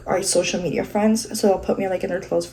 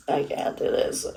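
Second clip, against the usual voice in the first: low, hushed giving up; defeated murmur; 7 semitones lower, 8 dB quieter than usual. Real, not acted. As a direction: say it under your breath, defeated. Gives up on the lashes.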